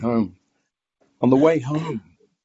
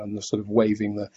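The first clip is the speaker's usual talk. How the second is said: usual speaking voice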